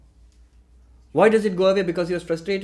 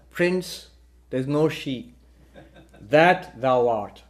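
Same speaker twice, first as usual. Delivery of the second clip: slow solemn revelation; 3 semitones lower, 3 dB louder, much slower than usual. Acted. Minister reveals the truth.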